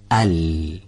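clean Arabic lām, no friction, no stop; correct L. Shown as a demonstration. Teacher showing students the right lām sound. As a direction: say ال with the tongue lightly touching.

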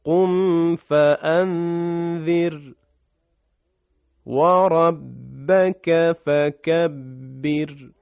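slow chanted recitation stopping on فكبر; recited with pause. Shown as a demonstration. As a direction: recite slowly, stop at the end. (تلاوت آهسته با وقف)

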